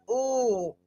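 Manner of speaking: ordinary talk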